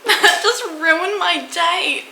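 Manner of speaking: high, dismayed complaint; real dismay at bad news; upset. Real, not acted. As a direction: lament it in a high, wounded voice. Upset at huge car repair estimate.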